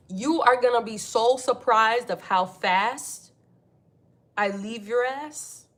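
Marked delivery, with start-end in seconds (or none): none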